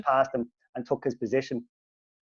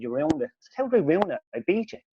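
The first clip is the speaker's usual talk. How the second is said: baffled retort; 9 semitones higher than usual. Retold or imitated. Acted bewildered retort to rival's complaint.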